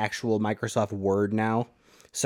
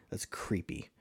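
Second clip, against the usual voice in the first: whispered; 4 semitones lower, 13 dB quieter than usual. Real, not acted. Reacting to creepy fact.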